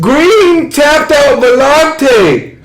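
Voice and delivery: high, very drawn-out yell; real stunned excitement; astonished announcement. Real, not acted. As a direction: stretch every word high in disbelief. Stunned by upset win.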